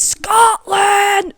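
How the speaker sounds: high shouted Scottish accent, one word; Scottish accent, high and loud. Shown as a demonstration. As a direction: belt it out in a broad Scottish accent. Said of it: "it's hard to do our accent".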